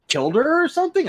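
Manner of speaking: panicked high rushed question; fast, high, alarmed; freaking out. Retold or imitated. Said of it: "Sen starts freaking out".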